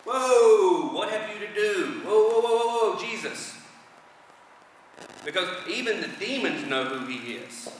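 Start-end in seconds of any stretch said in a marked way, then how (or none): 0.0-3.5 high, loud drawn-out whoas; mock alarm of a demon recoiling; panicked demon. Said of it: "That's the demon talking".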